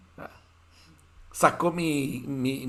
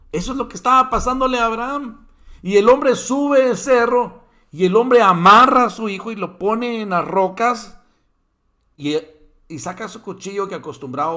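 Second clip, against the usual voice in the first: preaching at full force; 9 semitones higher, 11 dB louder than usual. Real, not acted. Preacher climaxes the story.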